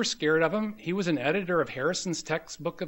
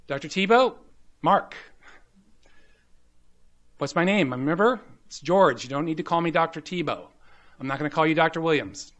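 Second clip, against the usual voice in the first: insistent reminder; 2 semitones higher, 6 dB louder than usual. Retold or imitated. Attending reminds intern, louder again.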